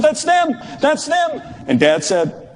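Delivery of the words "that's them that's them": put-on high, thrilled cry of a kid spotting his heroes; excited shout. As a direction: blurt it out, high and eager. Brother spotting the players.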